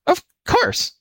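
very high mock-frightened jackal; put-on tremble of fear; pretended fright. A narrator's reading. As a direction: squeak it out in feigned alarm. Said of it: "pretending to tremble with fright".

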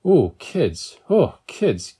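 sighing out the words in relief; exhaled relief. A narrator's reading. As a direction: let each phrase fall on an exhale. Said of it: "Lee exhaled".

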